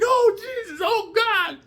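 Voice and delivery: hammed-up falsetto woman crying out; mock ecstatic cry. Woman in throes of sex.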